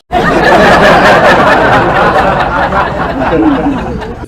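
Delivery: loud high burst of laughter at own joke; big laugh. Real, not acted. Teller laughing at their own joke, loud laugh. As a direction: crack up at the punchline.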